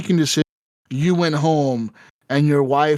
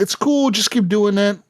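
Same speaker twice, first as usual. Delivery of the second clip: much higher, sweet placating voice; mock reassuring; 6 semitones higher than usual. President placating his wife, high mock-sweet voice. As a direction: soothe her with a pitched-up mock niceness. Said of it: "trying to be nice to your wife".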